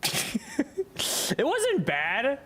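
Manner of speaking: slow laughing words. Real, not acted. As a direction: laugh through it. Laughing, stretched.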